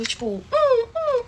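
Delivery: gemido agudo e lento de cachorro; imitando cachorra chorando. Retold or imitated. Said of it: "esses barulhos assim". (imitando choro de cachorra)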